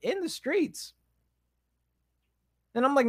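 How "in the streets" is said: high-pitched, incredulous protest; mock indignation. Congregant retelling his reaction to the pastor's sermon, mock indignant. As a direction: protest in a raised, affronted pitch.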